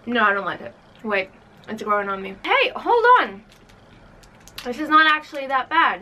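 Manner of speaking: talking while chewing chocolate; words muffled by food; mouth full, chewing. Real, not acted. Seen 3: Left speaker bit chocolate at -9, lips pressed while talking, chewing.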